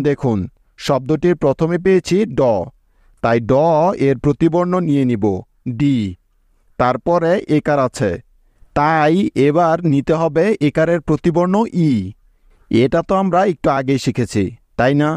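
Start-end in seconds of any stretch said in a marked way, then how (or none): none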